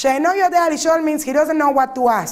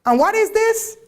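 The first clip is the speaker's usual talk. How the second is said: quoting Tevye; 7 semitones higher than usual. Retold or imitated. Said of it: "he grabs his tzitzit and he says".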